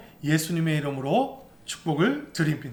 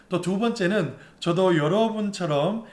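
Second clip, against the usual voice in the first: usual speaking voice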